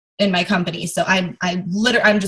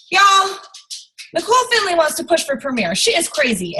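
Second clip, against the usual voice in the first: put-on Southern accent; 8 semitones higher than usual. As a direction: imitate the upline's Southern accent. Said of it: "That's my Southern accent".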